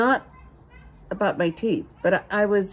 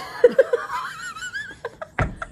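a sudden laugh, much higher; high burst of laughter. Real, not acted. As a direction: laugh out at the awkward moment. Laughs at the awkward hand.

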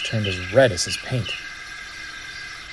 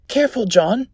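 put-on high-pitched boy's voice; high, cautious youngster; worried boy; 9 semitones higher than usual. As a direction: warn your friend up a tree. Boy warns friend in tree.

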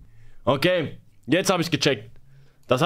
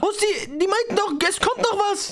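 outraged disbelief; 9 semitones higher than usual. Real, not acted. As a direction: rant at the screen in disbelief. Streamer outraged, result delayed. (fassungslos und empört)

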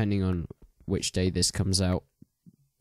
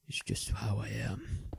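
soft slow whispered aside; 7 dB quieter, slower than usual. Pretend. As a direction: drop to a whisper, half joking. Aside after German accent remark.

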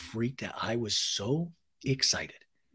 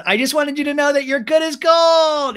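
high, loud gushing presentation; hammed-up proclamation; 12 semitones higher, 9 dB louder than usual. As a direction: imitate a big, excited reveal. Acts singer presenting her album.

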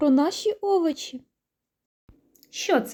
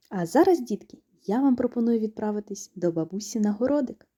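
usual speaking voice

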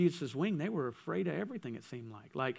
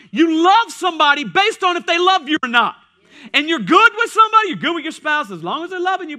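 loud, higher-pitched sermon delivery; preaching; 10 semitones higher, 13 dB louder than usual. Real, not acted. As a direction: preach it out with force. Preaches at full force.

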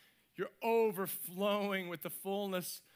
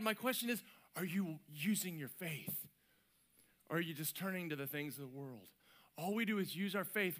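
normal talking voice

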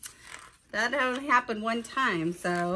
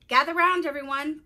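louder, higher announcement to gather a crowd; calling out; 6 semitones higher, 8 dB louder than usual. Acted. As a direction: summon everyone like a school principal. Principal calls the children together.

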